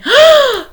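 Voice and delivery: high loud gasp of shock. Acted. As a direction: gasp in horror. Gasp at penguin story.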